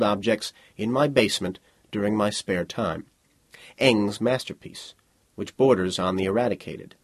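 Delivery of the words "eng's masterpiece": usual speaking voice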